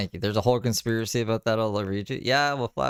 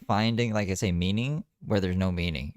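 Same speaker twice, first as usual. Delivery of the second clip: ordinary talk, nothing marked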